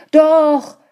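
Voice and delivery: high, loud protest; loud cry. A narrator's reading. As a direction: shout to contradict his wife. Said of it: "rief der Mann".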